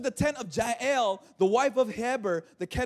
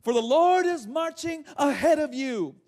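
higher, ringing proclamation; higher-pitched Deborah; 4 semitones higher than usual. Acted. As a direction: raise the pitch for a woman prophet's call. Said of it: "a higher pitch 'cause it was Deborah".

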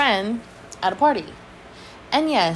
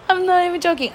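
laughing; 9 semitones higher, faster than usual. Real, not acted. Laughing while telling story.

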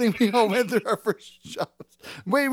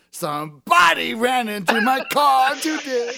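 stretched, higher belted complaint; mock singer's opener; 4 semitones higher, slower than usual. Friend telling what happened today, mock belted delivery. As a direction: belt the first word like a rock hook. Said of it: "he just always starts every".

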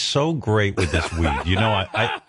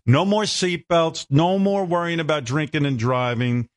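mock outraged rant; 8 semitones higher than usual. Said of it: "I'm like".

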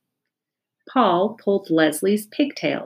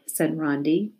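usual speaking voice

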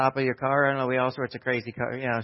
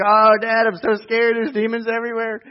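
imitating scared kid; 10 semitones higher, 9 dB louder than usual. Son voiced in story.

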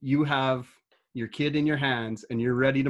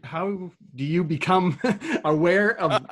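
laughing through the question; giggling while asking; 6 semitones higher, 4 dB louder than usual. Real, not acted.

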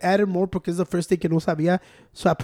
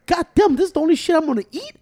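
retold shock; 8 semitones higher than usual. Retelling his shock at tiny portions.

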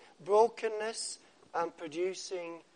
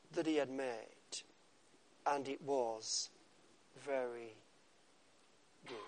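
soft reverent reading; 8 semitones lower, 11 dB quieter than usual. Shown as a demonstration. Reading scripture softly, slowing.

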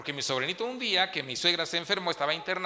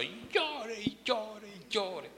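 mock wailing; 3 semitones higher, 5 dB quieter, much slower than usual. Mimicking endless crying.

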